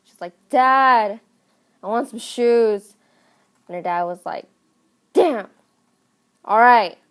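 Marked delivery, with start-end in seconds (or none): 0.5-3.0 louder, drawn-out mock whine for a treat; demanding daughter. Entitled daughter asks Dad for shoes, demanding. 5.1-7.0 exasperated dad. Retold or imitated. Dad gives in to daughter, loudly exasperated.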